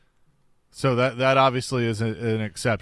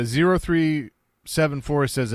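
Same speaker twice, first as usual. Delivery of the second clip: ordinary talk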